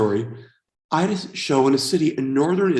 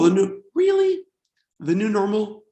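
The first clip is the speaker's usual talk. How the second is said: incredulous; 6 semitones higher than usual. Acted. Mocking the phrase new normal.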